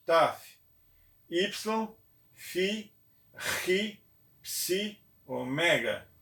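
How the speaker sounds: each Greek letter called out on its own, clearly; teacher reciting the Greek alphabet. Shown as a demonstration. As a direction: drill the letters one by one. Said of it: "Então, novamente".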